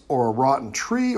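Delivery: usual speaking voice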